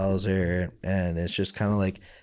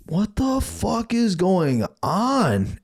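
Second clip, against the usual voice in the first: high, slow shocked outcry; 12 semitones higher, much slower than usual. Retold or imitated. Me to Mom in the bathroom, high and slow in shock. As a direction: draw it out in high disbelief. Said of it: "I'm like".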